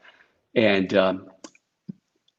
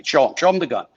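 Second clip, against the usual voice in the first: higher, pushy call to show it; 6 semitones higher, 4 dB louder, faster than usual. Retold or imitated. Comic telling the agent to show [name], lightly raised insistence. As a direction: urge him on like a showman. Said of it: "And he said".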